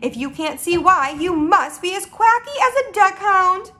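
mildly heated scolding; indignant cry. Acted. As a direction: rattle off the insult in exasperation. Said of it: "cried the B F G".

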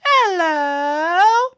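high squeaky little pink blob; tiny cute creature greeting. Acted.

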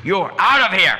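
high, very loud mock dismissal; wrong-mood outburst. Devotee throwing out the so-called master, mock furious shout. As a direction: yell it like throwing someone out. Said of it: "not always with the right mood".

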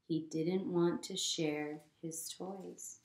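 usual speaking voice

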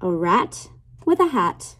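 lilting picture-book read-aloud; reading to children. Acted. Teacher reading a rhyming book to her class, lilting read-aloud. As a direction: read the rhyme to little kids, savoring each line.

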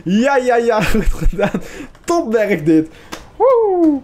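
high and loud, laughing with joy; excited cheering. Real, not acted. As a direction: cheer the team on like they just won. Team wins the fight, cheering. (uitgelaten gejuich)

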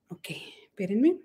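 soft whisper while busy; whispered aside. Real, not acted. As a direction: murmur it aside under the breath. Aside while replying off-camera. (susurro rápido aparte)

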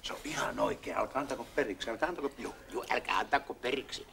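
hushed whispered encouragement. Acted. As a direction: whisper it so nobody hears. Visitor urges him secretly.

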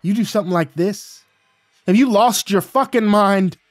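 loud, high, furious yell building to the end. Acted. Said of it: "I yelled".

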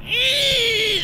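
loud, high-pitched crashing whine; sudden high mechanical squeal; imitated helicopter noise. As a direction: screech like a helicopter going down. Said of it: "That was literally the noise it made".

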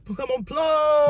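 high, loud, drawn-out shout; mock rapper yell, held long and high; imitating rapper's shout. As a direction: stretch it out like a shouted rap hook. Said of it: "Is that when he's like".

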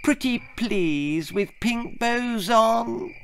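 high, slow, syrupy wheedle; the daughter keeps coaxing her father, slow and sugary; sugary pleading. Acted. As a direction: draw it out sweetly to melt him. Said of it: "in her most sugary voice".

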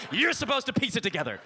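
ordinary talk, nothing marked

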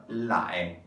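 low, drawn-out लाए; लाए said deliberately for learners; pronunciation of लाए. Shown as a demonstration. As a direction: model लाए as one long, low syllable. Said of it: "इसको हम कैसे pronounce करेंगे".

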